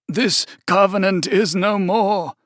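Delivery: put-on a quaking old man declaring formally; solemn cultist.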